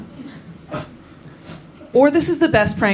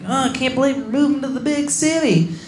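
mock worried friends; 5 semitones higher than usual. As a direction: coo it like a fretting friend. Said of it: "they were like".

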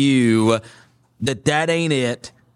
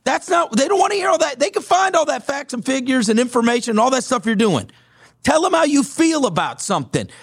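lightly voiced pundit, higher and punchy; a hint of a fired-up radio host ranting; retelling the radio host; 9 semitones higher than usual. Host lectures the young producer.

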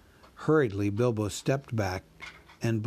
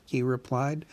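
plain reading voice

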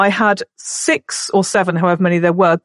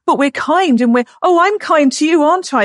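high-pitched mimicry of children's mantra; mock a child insisting on their kindness; parroting kids; 7 semitones higher than usual. Kids parroting the kindness mantra, mimicked in high voice. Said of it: "This was this mantra".

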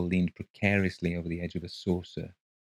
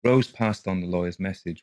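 plain reading voice

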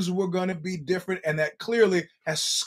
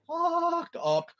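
suddenly high, drawn-out delivery; comic high pitch; 11 semitones higher than usual. Real, not acted. Host joking about a fighter taking a beating, high-pitched. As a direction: stretch it out way up high.

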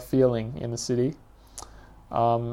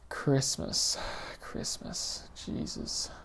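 breathy exasperated whisper, fading away; a narrator whispering to himself about Christmas, exasperated; whispered aside; 11 dB quieter, much slower than usual. Acted. Whispered aside. Muttered aside in essay reading.

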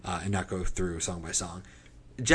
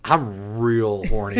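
laughing; 7 dB louder, much slower than usual. Pretend. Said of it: "that dude just, like".

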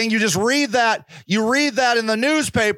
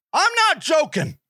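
ordinary talk

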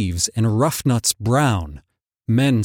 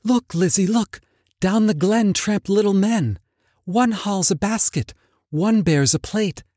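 whispered; 7 semitones higher than usual. Acted. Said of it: "whispered like the restless brook".

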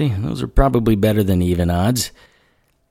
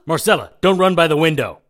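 urgent warning shout; 10 semitones higher, 3 dB louder than usual. Acted. Warning during arrow attack.

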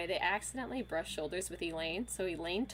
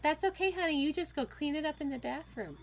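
higher, mildly soothing mom voice; mock soothing; 8 semitones higher than usual. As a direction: soothe her like a little kid, half joking. Comforting friend who peed.

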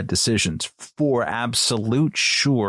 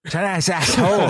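jeering caricature, pitched way up and louder; mock broken English; 9 semitones higher, 4 dB louder, faster than usual. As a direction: repeat it high and loud in a hammed-up foreign voice. Echoing a crude mock quote.

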